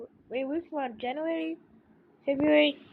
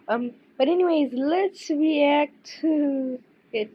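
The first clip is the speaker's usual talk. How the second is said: ordinary talk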